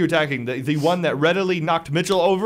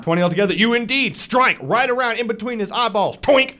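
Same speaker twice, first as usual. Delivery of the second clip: higher, animated combat narration; lively blow-by-blow storytelling; game master's action narration; 3 semitones higher, 3 dB louder than usual. Acted. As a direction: narrate the strike with punch and relish.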